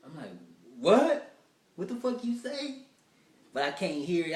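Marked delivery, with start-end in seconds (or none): 0.8-2.8 high, loud indignant "what?"; shouted confusion. Retold or imitated. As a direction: yell back at her, baffled. Yelling at date he can't hear.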